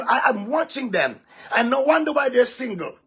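usual speaking voice

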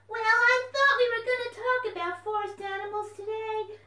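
high, loud, excited puppet voice; a bouncy little puppet popping up; puppet fox voice. Acted. Puppet fox greets the children, high and loud.

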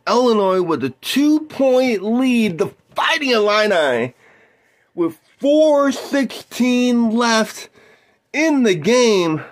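excited play-by-play caller; sports announcer. Acted. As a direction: call the game like a TV announcer. Plays basketball play-by-play.

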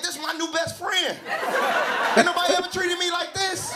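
high-pitched mock delight; mock gushing. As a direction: gush like someone with a new best friend. Acting out his gushing inner reaction.

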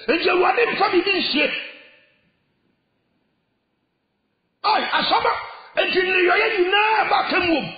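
shouted high, full-force sermon cry; fiery preaching. Acted. Preacher warning the congregation of judgment, shouting at full force.

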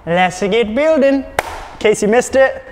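rally the lads and lassies in a hammed-up Irish accent; a playful Irish accent. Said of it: "a terrible Irish accent".

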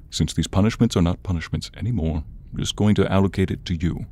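ordinary talk

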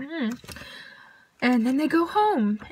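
usual speaking voice

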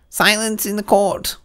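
high, loud, slow court call; the Rabbit calling the court to order; cried out. A narrator's reading.

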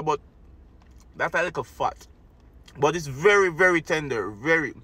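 chewing while talking; talking between bites; mouth full. Real, not acted. Seen 5: bit meat at -1, talks chewing right after.

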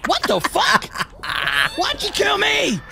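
high, slow outraged cry. Real, not acted. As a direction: protest being killed. Killed by teammate.

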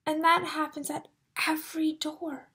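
hushed suspense whisper. Real, not acted. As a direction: lean in and whisper. Suspenseful storytelling aside.

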